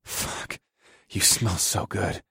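whispered curse, then slow murmur; hushed desire. A narrator's reading. Husband intimately to his wife, hushed desire. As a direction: murmur it slowly under your breath.

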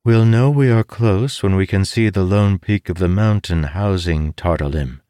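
a hint of a learned woman explaining; lightly voiced scholar. A narrator's reading. Bayham describing route.

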